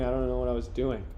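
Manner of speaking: ordinary delivery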